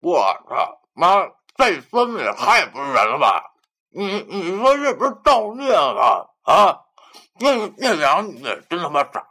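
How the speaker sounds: loud, high, outraged character voice; angry through gritted teeth. A narrator's reading. Friend with mouth full cursing a two-timer, furious. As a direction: rant in disgust, spluttering with a full mouth. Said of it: "对我咬牙切齿地说".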